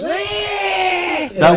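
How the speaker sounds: long, high, stretched-out yell of disbelief; exasperated howl; drawn-out exasperated shout. Real, not acted. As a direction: drag it out in disgust. Exasperated outburst at movie scene.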